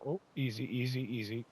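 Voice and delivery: quiet easy easy, like calming a horse; soft coaxing. Real, not acted. Player soothes the brush on screen, softly coaxing. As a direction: ease it along softly.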